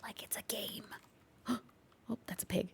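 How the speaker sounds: whispered aside. Real, not acted. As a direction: whisper to yourself. Hunting a hidden player.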